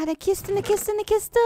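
high squealing chant over "eine Kiste"; excited singsong. Real, not acted. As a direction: sing it out, thrilled. Finds a chest in tower.

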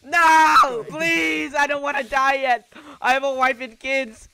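hammed-up plea for your life; mock begging.

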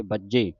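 ਬੱਜੇ with a held double j; tight doubled consonant. Shown as a demonstration. Teacher showing the adhak, ਬੱਜੇ said tightly. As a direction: press firmly on the jj in ਬੱਜੇ. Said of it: "ਬੱਜੇ ਕੱਸ ਕੇ ਬੋਲਣਾ ਹੈ".

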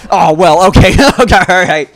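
loud, high words breaking into laughter; laughing. Real, not acted. Player reacting to a bad start, laughing. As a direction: laugh helplessly through the words. Laughing after things go badly in game.

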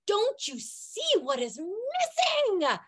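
pleading imagined cry. Pretend. Imagined naked scream in library.

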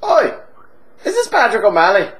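boozy Irish pub regular, chatty and cheeky; hammed-up Irish drunk telling a tale; stage Irish accent. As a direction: lay on a thick comic Irish brogue. Held Irish voice.

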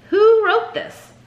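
sharp, high, slow demand; dark question. A narrator's reading. Teacher demanding who wrote the letter, dark and sharp. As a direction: demand it of the boy darkly. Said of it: "asked darkly".

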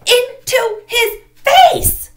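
very high, loud, slow scream. A narrator's reading. Girl screams that she smashed mud in his face. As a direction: scream it in horror. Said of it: "she screamed".

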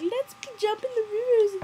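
put-on high, loud, sing-song girly voice; mocking Bella voice. Mocking Bella inviting her husband into the river. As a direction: chirp it like a giddy bride. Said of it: "And she's like".

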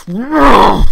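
a loud, high, drawn-out yell; frustrated yell. Real, not acted. Gamer losing the round, loud frustrated yell. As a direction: bellow it in frustration.

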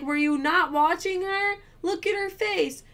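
retold incredulous scolding of a partner; pissed off. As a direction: draw out each accusing word. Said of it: "I was so pissed".

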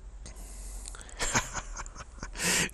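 soft, breath-led laughter. Real, not acted. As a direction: chuckle softly at your own feat. Laughs after completing the abstraction.

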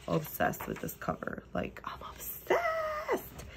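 breathy excited whisper. Real, not acted. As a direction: hush it like a secret crush. Whispering obsession over cover.

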